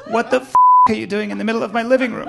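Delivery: loud high outraged shriek at the husband; shocked wife. Retold or imitated. Wife walking in on butter bath, loud shocked outrage. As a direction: yell it in disbelief. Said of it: "They're just like".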